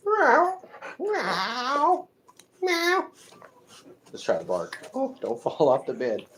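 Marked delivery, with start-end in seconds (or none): 0.1-3.1 high, drawn-out mock meows; cat meow. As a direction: mew like a cat. Imitates a cat for the pet.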